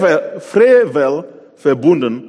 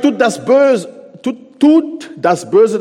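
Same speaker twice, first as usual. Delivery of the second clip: much higher and louder, slow and forceful; God's command; 8 semitones higher, 6 dB louder, slower than usual. Acted. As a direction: proclaim it as God's order, dragging it out. God commands putting away evil. (laut, hoch und langsam als Gottes Befehl)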